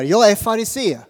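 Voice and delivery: proud cry of belonging; calling out. Retold or imitated. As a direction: call out your claim to the council. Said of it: "står det att han ropar".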